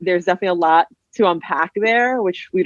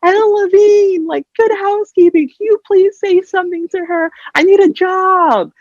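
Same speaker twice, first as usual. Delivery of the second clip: put-on pleading kid voice; 13 semitones higher, 3 dB louder than usual. Daughter begging her father for a job, high pleading whine. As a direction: beg your dad like a whiny kid. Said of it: "I was like".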